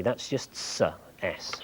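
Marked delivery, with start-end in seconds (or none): 0.5-0.8 "s" as the bare consonant sound; single phoneme. Shown as a demonstration. Presenter coding a word, "s" said as an isolated phoneme. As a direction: sound out "s" alone, not the letter's name. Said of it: "the first phoneme is easy".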